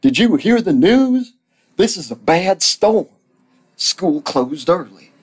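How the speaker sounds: loud, high-pitched urgent holler; calling out. Acted. Pat calls storm news to Stan, high shouting. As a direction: shout the news high, easing slightly at the end. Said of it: "called Pat".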